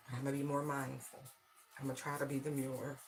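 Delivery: normal talking voice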